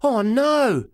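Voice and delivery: a small groan of doom; dismay. A narrator's reading. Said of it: "I say".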